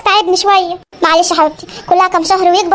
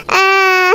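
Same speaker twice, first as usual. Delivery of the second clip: put-on baby cry; much slower than usual. Baby Ali wakes and cries.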